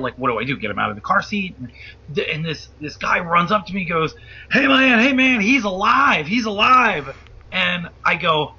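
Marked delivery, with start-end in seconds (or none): 4.5-7.1 higher, excited imitation of a man running up; urgent retold report. Man runs up to the driver after the accident, urgent and excited. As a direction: act out a man rushing over with news.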